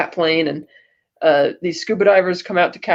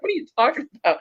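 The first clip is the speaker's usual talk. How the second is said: imitated bafflement; 7 semitones higher, 4 dB quieter, much faster than usual. Retold puzzled Batman.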